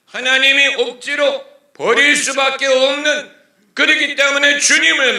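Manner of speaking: full-volume preacher's call; preaching at full force. Acted. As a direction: cry it out from the pulpit. Preacher climaxes the point.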